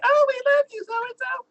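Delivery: very high swooning gush; gushing fans. Retold or imitated. Adoring crowd fawning.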